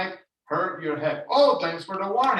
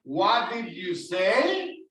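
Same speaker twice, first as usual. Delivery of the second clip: slow "What did you say?" ending on a rise; teacher leading class repetition; question tone; much slower than usual. Shown as a demonstration. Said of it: "Let me hear everybody".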